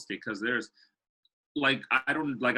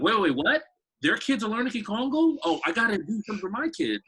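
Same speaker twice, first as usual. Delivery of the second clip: high, louder rush of sudden disbelief; excited surprise; 10 semitones higher, 4 dB louder than usual. Retold or imitated. Congolese parent in New York hearing of Kikongo classes, excited surprise. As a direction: jump up in pitch like hearing big news. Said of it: "they're gonna be like".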